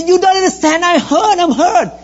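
high mock crying wife, laughing through it. Pastor mimics his wife crying to him. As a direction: squeak out the hurt like the crying wife. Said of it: "Dad keep crying and say".

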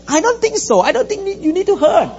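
high, laughing mock of his old dismissive self; dismissive husband. Husband mockingly retells brushing off his wife. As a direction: brush her off, half laughing. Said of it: "I said".

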